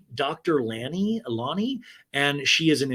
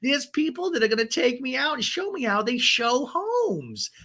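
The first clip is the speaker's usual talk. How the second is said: put-on much higher girly voice, slowing on sauce; sing-song it high, drag the confession out; high, breathless Gina; 13 semitones higher, 3 dB louder than usual. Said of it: "Gina's like".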